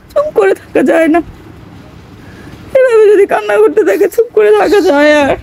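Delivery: real tears, voice high and breaking; crying through the words, can't hold it in; mother weeping over her sobbing son. Real, not acted.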